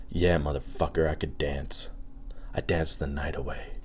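soft, low mock-solemn champion voice; underplay the cool disco king, quietly; dead-serious disco champion. Champion after winning the dance contest, low mock-serious boast. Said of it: "and he was like".

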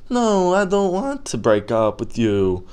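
put-on high-pitched pitiful boyfriend; mock pleading. Acts boyfriend begging not to split.

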